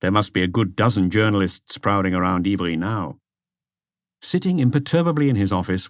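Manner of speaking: usual speaking voice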